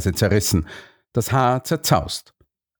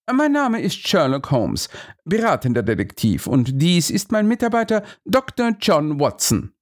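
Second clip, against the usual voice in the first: higher, self-assured detective; Holmes character voice; 7 semitones higher than usual. Acted. Holmes introduces himself to the policeman, self-assured. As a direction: introduce yourselves with pride.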